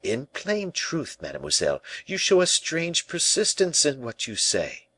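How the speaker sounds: high, measured royal displeasure; restrained anger. A narrator's reading. Angry king reproaches La Valliere for persisting, restrained. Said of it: "turning pale with anger".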